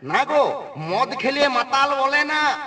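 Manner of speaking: normal talking voice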